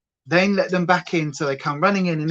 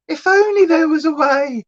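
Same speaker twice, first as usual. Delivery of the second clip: wistful sigh; 10 semitones higher, 6 dB louder than usual. Retold or imitated. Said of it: "And I look at him and go".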